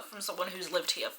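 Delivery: usual speaking voice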